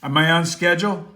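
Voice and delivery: breaking up on the line; fuzzy bad phone connection; crackly cordless phone. Real, not acted. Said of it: "you're all fuzzy".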